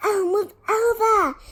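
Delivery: put-on exasperated girl taking charge; impatient snarl. A narrator's reading. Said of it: "Hermione snarled".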